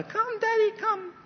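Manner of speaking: put-on a timid kid pleading softly; little child. Mehmet on the stairs calling his dad, high childlike.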